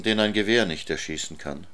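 ordinary delivery, nothing marked

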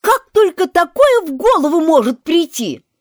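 high, loud indignant question; loud protest. Acted. Alice indignantly protests to the court, loudly. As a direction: keep up the outrage.

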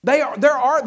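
ordinary talk

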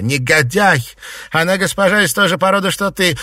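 high, loud, rapid abuse; angry outburst. A narrator's reading. Mistress out of patience cursing a servant, angry outburst. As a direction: burst out scolding, loud and high. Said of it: "не вытерпев, в сердцах плюнула".

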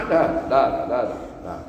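ordinary talk

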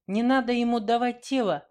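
high, loud indignant protest; indignant outburst. Acted. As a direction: snap it out in outrage. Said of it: "возмутилась Вера". (возмущённо, громко и высоко)

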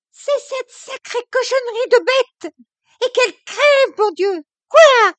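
high, loud, furious offstage yell; a young wife yelling at the maid behind a door; ringing angry shout. A narrator's reading. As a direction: shout it through a closed door. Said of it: "La voix éclatante de Camille".

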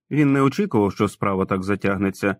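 ordinary delivery, nothing marked

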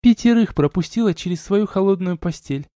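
high, taunting female voice; mocking wife. A narrator's reading. Wife provoking her questioning husband, smirking taunt. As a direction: needle him as a smirking woman. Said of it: "усмехнулась стервочка".

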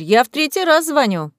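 ordinary delivery, nothing marked

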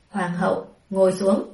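a lightly voiced stern emperor, short and firm. A narrator's reading. Emperor ordering the empress to sit, mildly stern. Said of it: "ngữ khí nghiêm khắc".

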